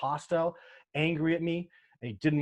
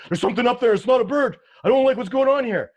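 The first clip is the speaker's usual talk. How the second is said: high, loud, alarmed shouting; spooked cameraman yelling high and loud; panicked camera guy; 11 semitones higher, 11 dB louder than usual. Retold or imitated. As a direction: blurt it out scared after running downhill. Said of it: "comes running down the hill with his camera".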